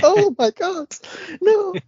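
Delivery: high, wailing cry of embarrassment; loud, high hammed-up dismay; mock horror. Acting girl whose top comes off.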